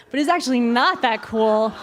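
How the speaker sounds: ordinary delivery, nothing marked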